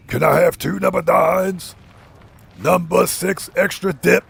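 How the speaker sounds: slow, drawn-out monster voice; grinding deep sandworm. Acted. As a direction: order food like a giant sandworm. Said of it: "The sandworm says".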